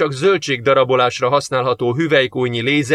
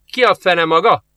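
higher, louder frightened snap; startled; 6 semitones higher, 5 dB louder than usual. A narrator's reading. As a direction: blurt it out in fright. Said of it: "A lány rémülten megfordult". (ijedt, felcsattanó kérdés)

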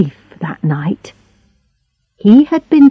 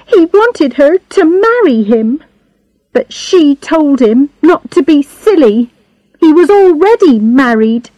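put-on higher-pitched girl, hurt and jealous; jealous Charlotte; 7 semitones higher than usual.

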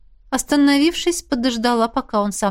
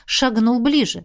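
ordinary delivery

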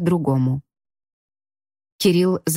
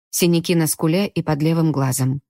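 plain reading voice

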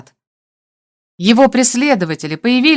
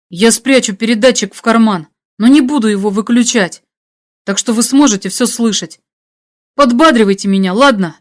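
lightly acted resolute radio voice; controlled, slightly raised delivery; composed hero in danger; 4 dB louder than usual. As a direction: give instructions steadily, ask for support. Hero steels himself, instructs friend.

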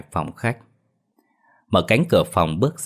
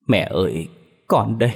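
mild sob on each word; tearful sniffling; 3 semitones higher than usual. Acted. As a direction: call to mother through sniffles. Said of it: "vừa thút thít vừa khóc lóc từng tiếng". (thút thít, nghẹn ngào gọi mẹ)

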